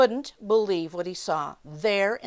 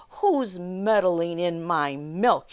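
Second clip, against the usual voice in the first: slow, loud, gruff demand; slow shout; 7 dB louder, slower than usual. Acted. Milkman catching the intruder, a slow loud shout. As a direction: boom it out slowly. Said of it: "he shouted".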